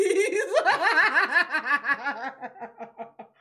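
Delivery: loud, sustained high laugh; long loud laugh. Real, not acted. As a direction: howl with laughter. Laughing at the joke.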